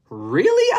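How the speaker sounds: high, loud astonished question; surprised daddy. Acted. Trixie's daddy hears she'll give the bunny away, high astonished question. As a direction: squeak it out in disbelief. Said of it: "asked Trixie's daddy".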